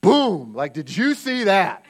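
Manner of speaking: loud, cocky showing off; shouting like a swaggering champ; mock bragging. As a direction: hype up the boys after a big win. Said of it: "that would have been my response".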